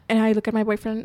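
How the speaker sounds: ordinary talk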